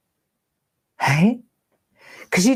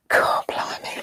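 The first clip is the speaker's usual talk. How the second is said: whisper; 5 dB quieter than usual. Real, not acted. Overwhelmed by old memories.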